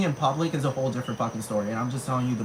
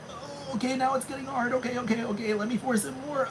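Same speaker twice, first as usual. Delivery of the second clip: higher, softer strained voice; mock straining; 10 semitones higher, 4 dB quieter than usual. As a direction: strain like it takes effort. Acted straining guy.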